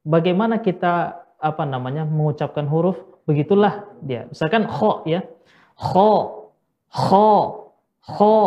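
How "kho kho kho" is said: "kho" said as if the mouth were stuffed with food, back of the tongue raised, higher; teacher demos a full, round "kho". Shown as a demonstration. Teacher shows students "kho" like a mouth full of food. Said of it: "mulut kita penuh dengan makanan".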